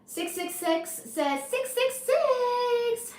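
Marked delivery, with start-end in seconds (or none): 1.4-3.1 very slow high stretched name; drawn-out reading. Pretend. Streamer reading a viewer's comment aloud, very slow and drawn out. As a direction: stretch each six out long.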